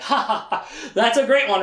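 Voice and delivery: mock amused laughter; laughing. Warren amused at Richard calling him young, acted laughing. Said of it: "he's, like, laughing".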